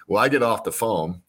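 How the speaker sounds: ordinary delivery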